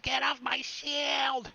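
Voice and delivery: high, loud drawn-out yell; screaming. Acted. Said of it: "the amount of screaming that [name] was doing".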